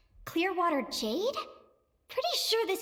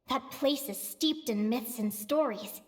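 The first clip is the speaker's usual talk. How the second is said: ordinary delivery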